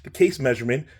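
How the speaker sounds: ordinary delivery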